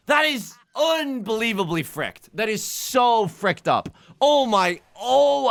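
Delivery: slow, high-pitched wail of real despair; despairing groan. Real, not acted. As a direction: drag it out in disbelief. After losing the hand.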